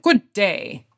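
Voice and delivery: mock British greeting; bad British accent. Shown as a demonstration. Host showing how a British greeting would sound, bad British accent. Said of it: "British way would sound bad".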